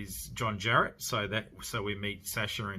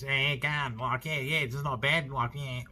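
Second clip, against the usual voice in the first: higher-pitched, over-the-top Australian accent; ramped Aussie accent; 5 semitones higher, 3 dB louder than usual. Shown as a demonstration. Reviewer showing viewers an exaggerated movie Aussie, ramped Australian accent. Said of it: "what I mean by a ramped Aussie accent is".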